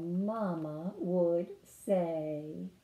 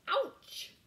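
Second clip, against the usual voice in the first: high squeaky ouch; a playful little yelp; mock ouch. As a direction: yelp as if a splinter stung. Frog imagining splinters.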